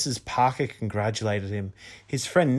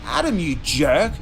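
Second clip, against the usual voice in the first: loud, higher angry outburst; 6 semitones higher, 5 dB louder than usual. A narrator's reading. Sister yells at her brother from the rubble, loud. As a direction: yell at him, furious. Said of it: "her voice rang out angrily".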